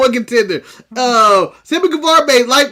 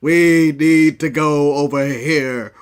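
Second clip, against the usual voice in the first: low, slow robot leader voice; slow Optimus voice; 9 semitones lower, slower than usual. Retold or imitated. Imitating Optimus Prime to cohost, low slow robot voice. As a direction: drag it out like a giant robot. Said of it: "how slow he talks".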